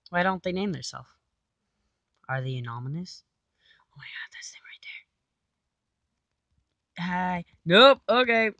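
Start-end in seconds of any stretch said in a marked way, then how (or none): 3.8-4.8 whisper. Real, not acted. Whispering on spotting enemies. 7.6-8.5 startled. Real, not acted. Sudden alarm in fight.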